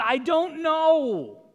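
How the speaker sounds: high, loud, lost little voice of the thief; bewildered thief. Acted. Thief tells the angel he doesn't know, high and bewildered. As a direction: play the clueless thief at heaven's gate.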